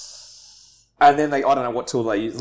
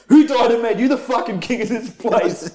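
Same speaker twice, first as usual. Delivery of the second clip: retold furious shout, much higher and louder; angry yell; 10 semitones higher, 5 dB louder than usual. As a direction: yell it like an outraged sergeant catching someone in his spot. Sergeant still yelling at him.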